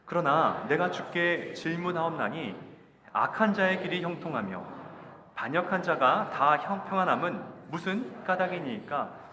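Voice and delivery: loud, slow, measured verse reading; scripture reading aloud. Acted. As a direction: lead the congregation through the verse, drawing out each phrase. Leads congregational scripture reading. (천천히 힘주어 읽는 성경 봉독)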